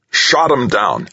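high, triumphant exclamation; exultant. A narrator's reading. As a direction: announce it with exultation. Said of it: "Norman Foster exulted".

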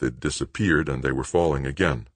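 ordinary delivery, nothing marked

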